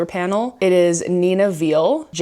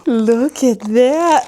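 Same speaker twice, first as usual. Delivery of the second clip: slow, higher, louder cooing at the dog; excited pet talk; 6 semitones higher, 5 dB louder, much slower than usual. Real, not acted. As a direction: drag it out, delighted, to the dog. Shows the dog the toy.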